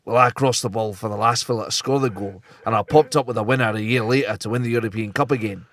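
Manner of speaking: put-on Scottish accent. Podcast host telling a joke as the Scotsman answering God, Scottish accent. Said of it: "the Scotsman replied".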